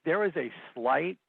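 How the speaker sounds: "slight" drawn out slowly for emphasis; stress on SLIGHT. Real, not acted. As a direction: lean hard on "slight", stretching it out. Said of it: "I emphasize the word slight".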